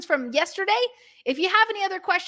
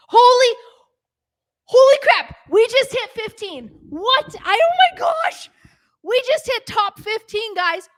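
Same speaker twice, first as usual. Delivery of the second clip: real shouting joy, much higher and louder; thrilled outburst; 7 semitones higher, 8 dB louder than usual. Real, not acted. Host shouting to live viewers over chart news, excited. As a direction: explode with disbelieving delight. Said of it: "I'm freaking out".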